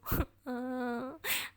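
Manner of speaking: rising wail of a sob; sobbing cry. Acted. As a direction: burst into tears. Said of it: "बहुत रो रही होती है".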